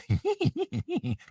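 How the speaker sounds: high-pitched little cartoon giggle; a chain of tiny high robot giggles; Clank's laugh. Shown as a demonstration. Actor showing the ad-libbed Scooby thing, signature laugh. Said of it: "the laugh we came up with".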